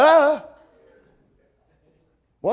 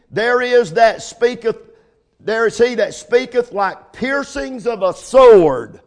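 slow, louder proclaiming; preaching scripture; 2 semitones higher, 6 dB louder, slower than usual. Acted. Preacher reading the verse to the congregation, slow and proclaiming. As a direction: ring out each word of the verse. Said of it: "preacher proclaiming scripture".

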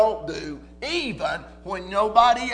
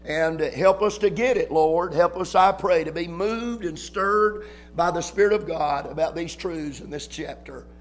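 prayer; 3 semitones lower than usual. Real, not acted. Prayer before the sermon.

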